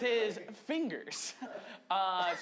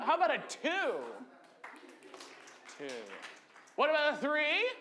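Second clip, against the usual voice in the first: hype host counting up; 10 semitones higher, 7 dB louder than usual. Real, not acted. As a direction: pump the crowd up, louder each number. Hyping up crowd, counting up.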